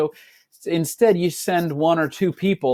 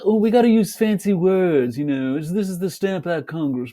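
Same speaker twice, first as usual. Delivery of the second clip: mock pompous officials; 3 semitones higher than usual. Teacher mocking the fancy-worded Congress, in a higher, puffed-up voice. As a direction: ham up self-important lawmakers. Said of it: "It's like, it's got a lot of".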